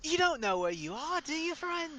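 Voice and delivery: put-on high, taunting kid voice; menacing kid. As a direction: needle the stranger, pitch climbing. GM voices kid threatening party.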